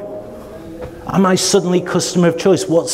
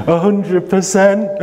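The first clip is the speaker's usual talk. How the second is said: cheeky naive buyer, a touch higher; 5 semitones higher, slower than usual. Retold or imitated. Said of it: "The naive procurement person at this point says".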